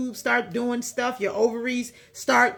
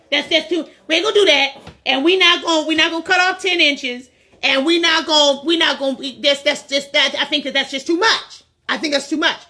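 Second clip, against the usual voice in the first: louder and higher, hammed-up haggling; loud mock bargaining; 4 semitones higher, 4 dB louder, faster than usual. Man refusing the cut, loudly offering to tuck instead. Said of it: "will say".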